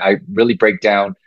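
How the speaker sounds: normal talking voice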